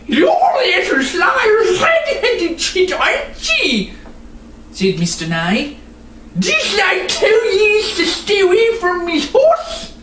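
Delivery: high, slow mocking threat from a rough old man; gruff villain. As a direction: sneer at the sly girl. Villain Mister Nye threatens girl.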